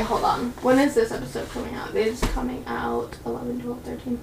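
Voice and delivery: very soft aside, almost inaudible; hushed, off-hand muttering; talking to oneself. Real, not acted. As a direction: murmur while checking a date. Checking a date to herself.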